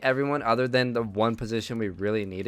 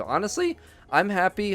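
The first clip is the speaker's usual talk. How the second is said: voice cracking; 8 semitones higher than usual. Real, not acted. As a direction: voice cracks up high on happy. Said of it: "That voice crack though".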